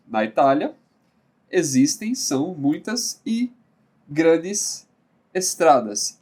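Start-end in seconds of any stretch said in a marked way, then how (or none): none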